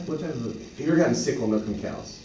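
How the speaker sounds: ordinary talk, nothing marked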